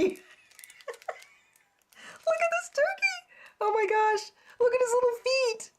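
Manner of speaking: high squeal over the little feet; squealed delight. Real, not acted. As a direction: squeal at something tiny and funny.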